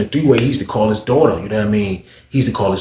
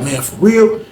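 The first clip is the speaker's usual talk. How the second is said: surprised; 9 semitones higher, slower than usual. Retold or imitated.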